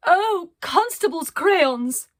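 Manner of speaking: high, loud and slow, grand eccentric lady; theatrical cry. Acted. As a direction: exclaim like a dramatic artist. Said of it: "cried Arcadia".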